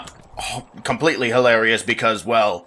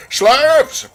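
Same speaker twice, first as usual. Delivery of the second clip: high commanding royal call; pompous king barking orders; 4 semitones higher, much slower than usual. Acted. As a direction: order the servants about like a spoiled king. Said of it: "get into character for my king voice".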